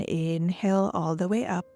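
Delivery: ordinary talk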